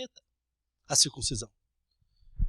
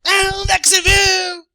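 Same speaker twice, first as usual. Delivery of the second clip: higher, slower singsong mock reply; mocking retort; 9 semitones higher, 9 dB louder, slower than usual. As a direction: taunt in a sing-song. Mocking cashier retort, sung.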